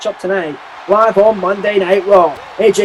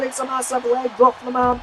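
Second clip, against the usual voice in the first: ordinary talk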